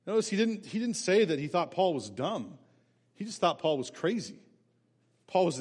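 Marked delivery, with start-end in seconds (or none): none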